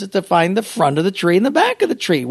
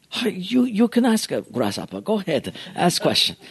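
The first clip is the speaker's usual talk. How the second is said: imitated Japanese accent, softer and broken; Mister Miyagi; 3 semitones higher, 5 dB quieter than usual. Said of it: "we'll just call him Mister Miyagi".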